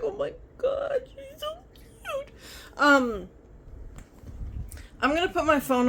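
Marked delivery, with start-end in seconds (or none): 0.0-2.3 very high squeal fading softer and slower; gushing. Retold or imitated. Remembering first seeing the little dog, high cooing squeal. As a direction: coo over the puppy, overwhelmed. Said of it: "I was like".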